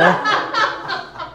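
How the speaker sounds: bright, high laugh at his own story; high burst of laughter. Real, not acted. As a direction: let a real laugh break out high.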